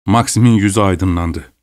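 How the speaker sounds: ordinary delivery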